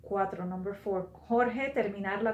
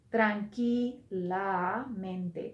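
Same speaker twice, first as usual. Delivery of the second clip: slow model; much slower than usual. Shown as a demonstration. Teacher models the adverb slowly.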